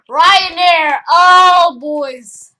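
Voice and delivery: loud, high cheer for a favourite; thrilled, drawn-out yell; excited shout. Real, not acted. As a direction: holler it out with delight. Thrilled reveal of next plane.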